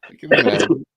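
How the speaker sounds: laughs through the words, high and loud; laughing it out at the buddy's story. Real, not acted. Laughing at the guest's funny story, high and loud.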